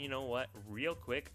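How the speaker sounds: ordinary talk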